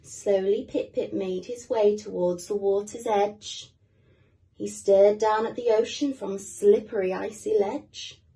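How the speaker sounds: lively storybook reading for little ones; reading to children. Acted. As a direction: read it like bedtime for small kids. Storybook narration continues.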